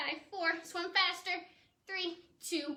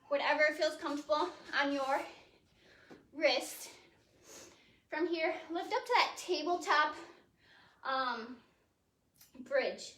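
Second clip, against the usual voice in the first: normal talking voice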